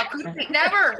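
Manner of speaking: high, loud, over-the-top insistence; playfully reassuring a left-out cohost, loud and high; mock protest. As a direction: swear it theatrically. Mock-protesting host's loyalty.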